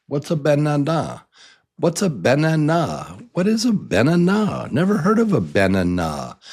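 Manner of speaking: puzzled questions with banana as ben-a-NAH; high questioning, banana ending on NAH; wrongly stressed banana. Shown as a demonstration. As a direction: puzzle over banana, stressing its last syllable. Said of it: "I'll say it for you wrong".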